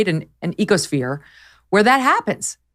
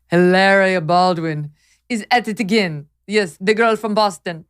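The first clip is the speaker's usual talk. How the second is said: put-on Hilaria's Spanish accent; 4 semitones higher, 3 dB louder than usual. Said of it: "I'm trying to imitate Hilaria doing her Spanish accent".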